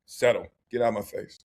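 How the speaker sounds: deep, gruff brush-off voice; a mock low dismissive growl. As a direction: drop the voice low and wave them off.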